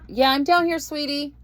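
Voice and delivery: calling out loud to someone upstairs; yelling across the room. Real, not acted. Answering niece from downstairs.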